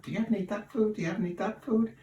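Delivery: high squawky duck begging for food. Acted. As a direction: pester like a hungry duck. Joke: speaker voices a begging duck.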